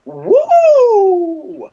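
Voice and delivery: long, high, loud mock "woo"; imitated woman's whoop. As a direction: let out a drawn-out high whoop, imitating her. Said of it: "but that noise".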